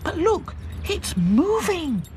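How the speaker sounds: very high, slow alarmed doll voice; Princess alarmed. A narrator's reading. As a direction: point in alarm. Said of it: "said Princess".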